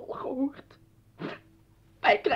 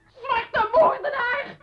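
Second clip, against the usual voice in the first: slow, sobbing scream, higher; crying shout; 8 semitones higher, 6 dB louder, slower than usual. Acted. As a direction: scream it through tears. Grace huilt en schreeuwt. (huilend schreeuwen)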